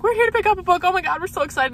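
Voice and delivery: imitating giddy fans in a high voice; high-pitched retold fan excitement; freaking out. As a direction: mimic two girls bubbling over. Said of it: "they were freaking out".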